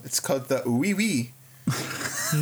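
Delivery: drawn-out deadpan punchline; deadpan, slow joke reveal; slow comic punchline. Acted. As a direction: stretch it out slowly for the gag. Joke delivered for comic effect.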